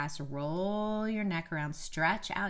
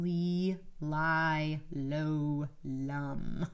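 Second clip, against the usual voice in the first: vocal warm-up syllables; 2 semitones lower, much slower than usual. Shown as a demonstration. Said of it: "I learned was saying".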